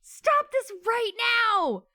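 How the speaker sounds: high, loud mock scream at the book; wanting to scream. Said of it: "I just wanted to scream".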